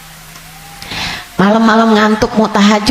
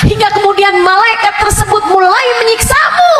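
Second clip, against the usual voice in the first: much higher, shouted sermon; fiery preaching; 11 semitones higher than usual. Acted. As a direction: preach the torment at full force. Preaching about the grave. (ceramah berapi-api, suara tinggi berteriak)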